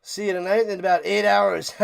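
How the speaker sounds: ordinary talk, nothing marked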